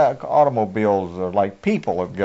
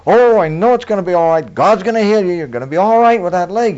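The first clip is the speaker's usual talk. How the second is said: higher, louder, gushing reassurance; higher and louder, lightly voiced eager believer; mock hopeful woman; 7 semitones higher, 5 dB louder than usual. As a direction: imitate a sweetly sure churchgoer. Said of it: "and the woman would say".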